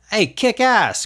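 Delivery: high, thrilled exclamation; excited. A narrator's reading. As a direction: pitch jumps up with delight. Excited at talking worm.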